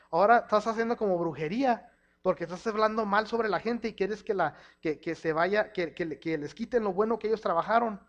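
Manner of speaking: ordinary talk, nothing marked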